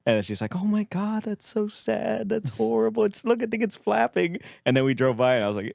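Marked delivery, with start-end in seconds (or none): 0.5-4.4 high-pitched, mock-upset voice; distressed woman imitation. Driver upset over a hit pigeon, distressed high voice. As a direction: imitate her fretting, much higher than usual.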